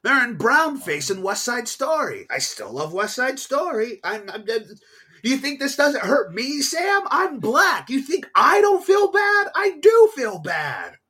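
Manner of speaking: high, loud wounded protest; mock anguish. Real, not acted. Arguing with Sam, high loud mock anguish.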